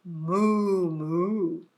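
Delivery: mildly voiced moo; cow moo. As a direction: moo goodbye.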